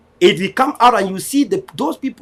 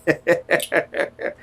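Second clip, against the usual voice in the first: a scornful laugh at critics; short laugh; 6 semitones lower than usual. Real, not acted. Speaker laughing at those who removed Vanguard. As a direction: laugh them off. Mocking critics mid-speech.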